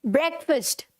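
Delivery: ब्रेकफास्ट as "BREK-fust"; short e and reduced second vowel in ब्रेकफास्ट; correct pronunciation. Shown as a demonstration. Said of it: "जैसे ये लिखा है ना".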